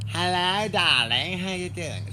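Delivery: high, loud falsetto lady greeting; Lady Godiva voice. Pretend. Vlogger greeting viewers as Lady Godiva, high and loud. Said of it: "Lady Godiva".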